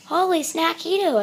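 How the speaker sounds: put-on high-pitched kitty boy exclaiming; excited little squeal of words; delighted exclamation. A narrator's reading. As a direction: exclaim over a tasty find. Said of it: "exclaimed the kitty boy".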